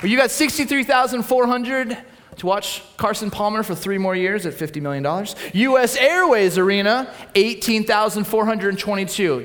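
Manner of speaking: hammed-up sports hype. Preacher riffing on Arizona teams, excited announcer. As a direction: hype the crowd like a game night.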